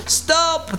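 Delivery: sudden high-pitched command; ordering. A narrator's reading. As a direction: call a halt in a high voice. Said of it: "the captain ordered".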